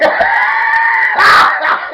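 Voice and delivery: very high, loud squeal of laughter; piercing cackle; loud shrieking laugh. Real, not acted. Laughing at guy fleeing.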